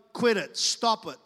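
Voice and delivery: normal talking voice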